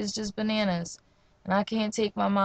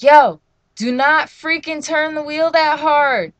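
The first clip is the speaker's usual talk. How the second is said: high, loud, slow retold command; drawn-out loud "don't you dare" warning; urgent warning; 6 semitones higher, 10 dB louder than usual. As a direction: warn the driver firmly and loudly. Retold warning to reckless driver.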